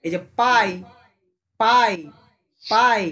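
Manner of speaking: পাই called out loud and clear; loud syllable demo. Shown as a demonstration. Teacher calling out পাই for students to copy. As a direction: drill পাই at the class. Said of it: "দেখ এইগুলো কিন্তু একটু ভালো করে".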